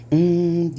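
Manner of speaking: loud mmm of delight; loud savoring mmm. Real, not acted. Tasting the meatballs.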